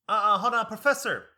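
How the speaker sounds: loud, high sassy objection; sassy interruption. Retold or imitated. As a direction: cut in loudly with attitude. Lady interrupting the professor, retold.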